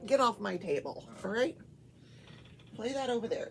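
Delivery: ordinary delivery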